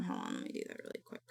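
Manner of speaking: quick unvoiced mutter; fast whisper. Real, not acted.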